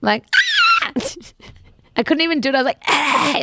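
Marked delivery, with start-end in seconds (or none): 0.3-0.9 very high squealed mock scream; short high scream on request; demonstrated scream. Shown as a demonstration. Host doing the sound for friend, attempted scream. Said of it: "Like, do the sound". 2.9-3.4 higher, louder-than-talk mock scream; imitated scream. Shown as a demonstration. Host showing friend how she screamed, mock scream. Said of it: "like, screaming".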